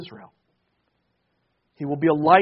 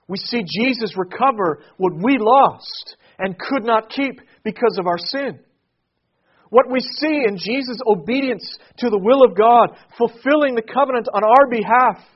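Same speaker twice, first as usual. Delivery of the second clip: higher, much louder climactic preaching; preaching at full force; 6 semitones higher, 8 dB louder than usual. Acted. Pastor telling congregation Jesus fulfils Israel's hope, preaching full force. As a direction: build to a ringing proclamation.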